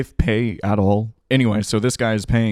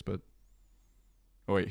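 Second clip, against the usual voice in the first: ordinary talk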